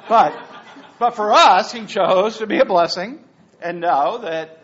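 loud, laughing thanks; laughing gratitude. Real, not acted. Laughing praise of Larry's favor.

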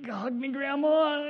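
high, slow, grudging; reluctant plea. Acted. Kid made to hug grandma, grudging and high. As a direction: say it like you're forced to.

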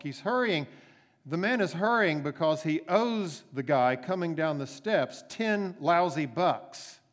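normal talking voice

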